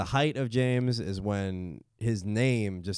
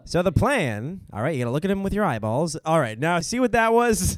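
put-on old-time gangster; 6 semitones higher, 5 dB louder than usual. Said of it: "be a blind gangster".

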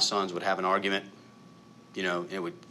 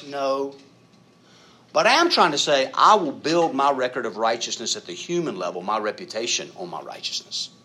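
normal talking voice

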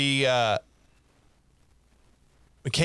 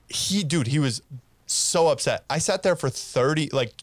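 normal talking voice

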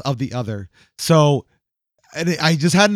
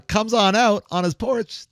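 usual speaking voice

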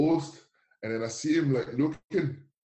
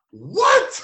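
high, loud baffled what; a startled confused what; 10 dB louder than usual. Retold or imitated. Newcomer failing to understand the Scottish greeting, high and loud.

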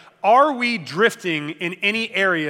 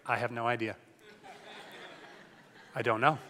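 lower, softer mock-wife voice "Not really feeling it"; mock bored wife; 7 semitones lower, 10 dB quieter than usual. Wife voiced in dinner skit.

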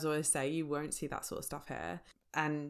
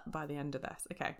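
ordinary talk

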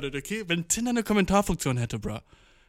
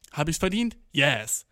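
ordinary talk

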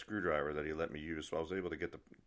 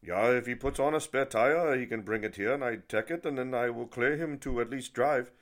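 raised-pitch imitated German accent; German inspector impression, higher voice; 5 semitones higher, 6 dB louder than usual. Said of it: "the German guy's like".

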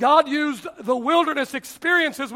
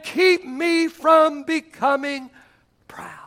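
drawn out emphasis; 3 semitones higher, much slower than usual. Acted. Slowed emphasis on scripture phrase.

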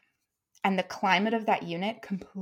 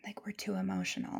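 mock whisper; 11 dB quieter than usual. Mocking workplace judgement.

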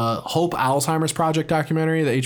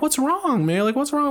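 much higher, coaxing aide's voice; concerned aide; 12 semitones higher than usual. Retold or imitated. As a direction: ask gently what's wrong, pitched up. Aide asking crying woman.

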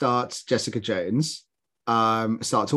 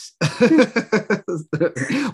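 high giggle at an awkward admission; embarrassed real giggling; real laugh; 8 semitones higher, 4 dB louder than usual. Real, not acted. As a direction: burst into high, helpless laughter. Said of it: "I don't know why I'm laughing".

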